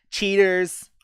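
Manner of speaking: hammed-up whine of cheaters; mock accusation. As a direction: jeer it like a sore loser. Repeats the word mockingly.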